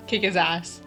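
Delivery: ordinary talk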